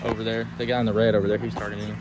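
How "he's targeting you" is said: ordinary delivery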